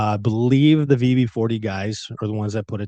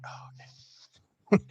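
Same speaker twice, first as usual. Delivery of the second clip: hushed retold reaction; 10 dB quieter, much slower than usual. Retold reaction to hospital news.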